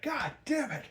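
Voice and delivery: high-pitched mock frustration; retold exasperated anger. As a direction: imitate someone pissed off and at a loss. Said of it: "he is pissed beyond all reason".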